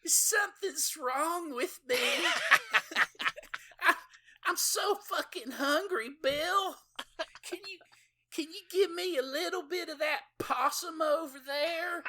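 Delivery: very high, whiny blubbering falsetto; whining monster woman. Retold or imitated. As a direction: wail it like a starving bloated blob. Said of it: "She's like".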